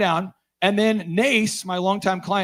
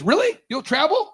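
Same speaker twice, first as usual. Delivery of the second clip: high, incredulous question; surprised wife asking again; 9 semitones higher than usual. Retold or imitated. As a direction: sound amazed he agreed. Wife surprised he will travel.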